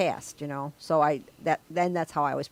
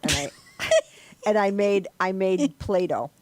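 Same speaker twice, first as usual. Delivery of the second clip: usual speaking voice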